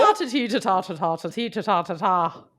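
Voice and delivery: nonsense syllables in a mock-Irish lilt; bad Irish accent. Mocking back after a tease, bad Irish accent nonsense. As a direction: lilt gibberish like fake Irish, falling away. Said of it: "you're not known for your Irish accent".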